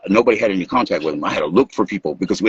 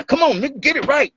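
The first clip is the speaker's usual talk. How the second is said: high mock scold, get it right; a half-joking push at a bandmate stuck on one verse; retold scold, pitched up; imitating scolding his partner; 14 semitones higher than usual. Scolding Ivan over the verse.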